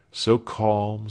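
ordinary delivery, nothing marked

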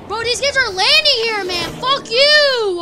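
high, loud furious shout; raging gamer cursing at landing enemies; angry yell. Real, not acted. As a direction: blast it at the enemy.

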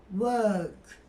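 "work." as "wöğk", r silent; soft ö in work. Shown as a demonstration. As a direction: draw a light ö before the k of "work.". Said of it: "Hafif bir ö sesinden sonra".

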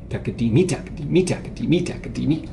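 "takadimi" with the last syllable MI accented; stress on MI. Shown as a demonstration. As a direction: chant takadiMI, punching "mi" each time. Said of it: "you put it on me".

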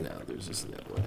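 whisper. Real, not acted. Whispered refusal.

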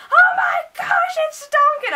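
very high, loud fangirl shriek; reenacted ecstatic squeal; excited screaming. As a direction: scream it slowly in delighted disbelief. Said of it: "I was screaming".

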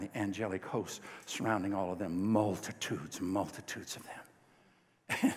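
slow breathy whisper, drawn out; awed whisper. Real, not acted. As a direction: let the words fall into a hushed marvel. Awed description of angelic host.

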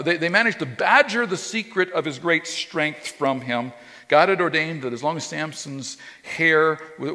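usual speaking voice

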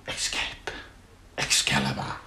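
unvoiced whispered murmur; whispering. Real, not acted. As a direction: breathe the words almost silently. Unexplained whispered asides.

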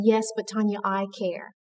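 a hushed, loving answer; gentle reassurance. Retold or imitated. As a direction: soothe her quietly as God would. God answers her tenderly.

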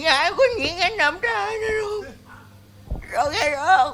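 put-on high, squeaky granny consoling a boy; old granny voice. Granny comforting the crying boy, in a high squeaky voice.

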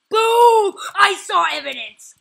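high, loud burst of excitement; triumphant shout. Real, not acted. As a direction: shout it like catching a cheater red-handed. Triumphant callout of spotted cheating.

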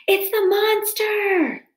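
high, drawn-out screech of alarm. A narrator's reading. Owl warning the crowd of a monster, screeching. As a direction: screech it slowly at a very high pitch. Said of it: "fluttered up from his branch and screeched".

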